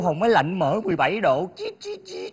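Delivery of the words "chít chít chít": high, tiny chirped beeps; imitated remote beeps. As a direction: squeak out little button beeps. Imitates the air-conditioner remote beeps. (tiếng bíp chít chít the thé)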